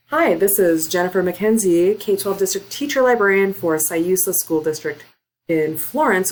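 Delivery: loud, projected podcast host intro; broadcaster voice. Shown as a demonstration. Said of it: "switched into, like, a broadcaster voice".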